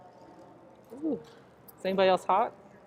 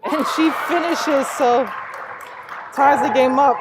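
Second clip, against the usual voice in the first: higher and louder, laughing as it falls; excited call with a laugh; 6 semitones higher, 9 dB louder than usual. Real, not acted. Commentator to fans, laughing excitedly at the tie. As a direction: shout the tying basket with a laugh. Game-tying basket.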